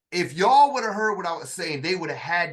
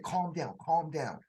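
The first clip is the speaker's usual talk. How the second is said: soothing calm voice; 7 dB quieter, slower than usual. Retold or imitated. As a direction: settle a panicking soldier gently. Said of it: "spoke to me in a totally different voice".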